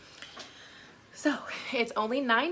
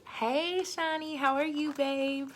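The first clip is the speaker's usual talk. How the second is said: higher cheerful hello; excited greeting; 6 semitones higher, 4 dB louder than usual. Real, not acted. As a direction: warmly greet a friend. Greeting a friend who joined.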